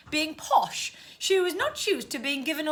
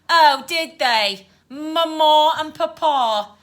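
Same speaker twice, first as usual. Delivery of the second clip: loud, slow mocking of posh speech; drawn-out, scornful Cockney mockery; rather smug; 3 semitones higher, 7 dB louder, slower than usual. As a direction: mimic the posh words smugly. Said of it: "replied in a rather smug tone".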